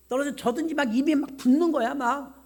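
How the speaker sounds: usual speaking voice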